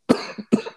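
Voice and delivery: sudden dry cough; a sharp real cough. Real, not acted. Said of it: "Excuse me".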